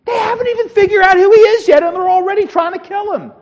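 very high, loud, rushed disbelief; incredulous outcry. Real, not acted. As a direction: cry it out high in sheer disbelief. Preacher repeats it in outraged disbelief.

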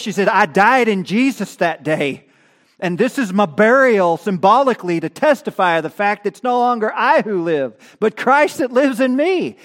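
loud, rising preacher's fervor; loud pulpit fervor; fervent preaching. Acted. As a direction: preach it out full force, building. Preaching at full force.

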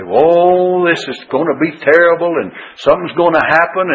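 a hint of hand-wringing panic; mock worry. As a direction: play the anxious believer. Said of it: "we get so worried and upset and say".

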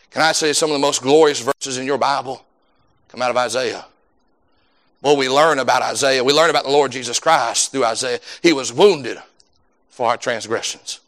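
usual speaking voice

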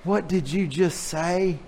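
slow, prim, scandalized mother; a proper Southern lady clutching her pearls, slowly; shocked genteel mother. Retold or imitated. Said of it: "it sounded something like that".

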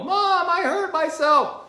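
put-on high, loud child's cry from off screen; daughter yelling. Daughter in the commercial yelling to her mom, high and loud. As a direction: call out like a hurt little girl. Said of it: "you hear the daughter yell".

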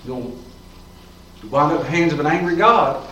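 ordinary talk, nothing marked

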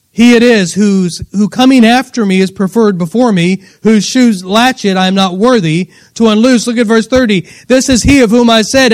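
loud, emphatic Bible reading; preaching scripture. Acted. Preacher reading John's testimony to the congregation, emphatic preaching. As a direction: read the verse out at full force, slowing hard at the end. Reads scripture at full force.

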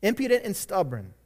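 normal talking voice

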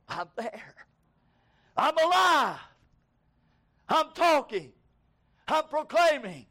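high, loud shouted preaching as the soul in heaven; full-force preaching. Acted. Preacher shouting the souls' voice to the congregation. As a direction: preach it out as the living soul at the altar.

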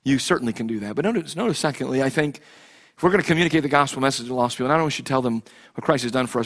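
normal talking voice

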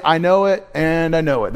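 mock shallow repetition. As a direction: toss off "I know it, and I know it" like an empty pop lyric. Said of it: "And so you could say".

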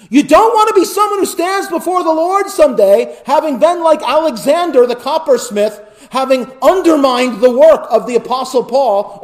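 high, loud warning from the pulpit; impassioned preacher's appeal; preaching at full force. Acted. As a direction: press the warning on the congregation.

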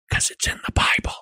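hushed, quick whisper; soft, confidential whisper; whispered aside. Acted. Whispered aside while preaching.

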